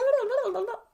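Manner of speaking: dismissive sing-song blah blah; mumbling blah blah. Shown as a demonstration. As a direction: toss off the words carelessly. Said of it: "They don't just blah".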